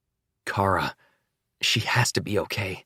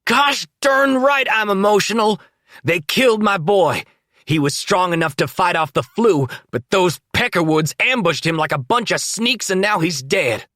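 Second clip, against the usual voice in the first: very high, loud furious yelling; father raging over his killed son; grief-stricken rage; 10 semitones higher, 5 dB louder than usual. A narrator's reading.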